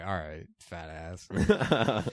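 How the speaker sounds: very slow, deadpan put-down; sarcastic retort. Acted. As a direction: drag it out with scorn. Sarcastic retort to Boogie's boast.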